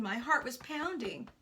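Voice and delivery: ordinary delivery, nothing marked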